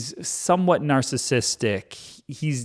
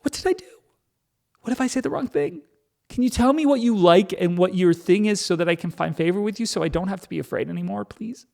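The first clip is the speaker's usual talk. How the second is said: mock terror; 6 semitones higher, faster than usual. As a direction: squeak it out like someone scared of a violent partner. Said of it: "so violent and so aggressive".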